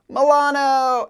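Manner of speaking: loud, slow drawn-out cheer of his name, pitched high; big happy welcome shout; group cheer. Retold or imitated. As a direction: hail the late rider as he rolls up. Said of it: "We all say".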